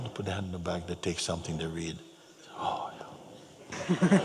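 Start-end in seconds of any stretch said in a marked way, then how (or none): 2.6-4.2 mock whispered wonder; hushed realization. People reading a thought for the day, whispered reaction. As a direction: let it out as a soft whisper.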